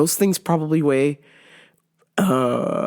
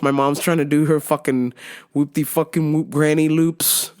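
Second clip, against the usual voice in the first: normal talking voice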